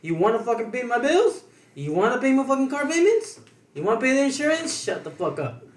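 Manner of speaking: imitating fed-up retort. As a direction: fire off rhetorical questions, then drop to shut them down. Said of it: "Fucking Juan got tired".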